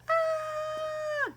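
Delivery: high startled yelp; squeaky high gasp of surprise. Acted. Character grabbed suddenly.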